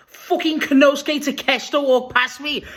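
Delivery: high-pitched excited outburst; amazed. Retold or imitated. Fan telling who walked past, amazed. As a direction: tell it in stunned excitement.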